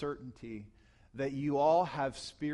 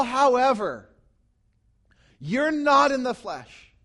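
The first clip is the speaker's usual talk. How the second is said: loud, high, impassioned preaching; preaching at full force; 6 semitones higher, 10 dB louder than usual. Acted. Pastor rousing his congregation, preaching loud and high. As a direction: drive Paul's words home to the church.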